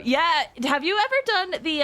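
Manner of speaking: ordinary talk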